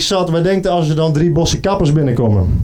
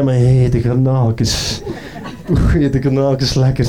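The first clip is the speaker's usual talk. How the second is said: slow savoring; 2 semitones lower, slower than usual. Retold or imitated. Lady savours word.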